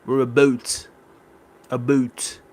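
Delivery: imitated Minnesota-Canadian "a boot"; Minnesota accent. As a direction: say about as "a boot", Canadian style. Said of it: "they kinda sound like Canada, Canadians".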